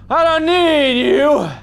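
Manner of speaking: high, drawn-out defiant cry; defiant holler. Pretend. Player rejecting a game object, defiant holler. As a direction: holler it high, stretching the words.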